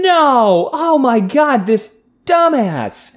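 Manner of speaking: high, drawn-out mock wail of exasperation; imitated outraged outburst. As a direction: imitate someone losing it at a fool. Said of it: "you just started going".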